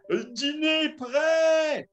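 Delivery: high, slow shouted call. Retold or imitated. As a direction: yell it across the clearing. Calling out dinner is ready. (appel crié, aigu et lent)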